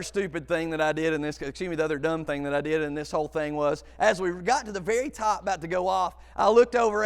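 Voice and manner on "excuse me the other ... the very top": usual speaking voice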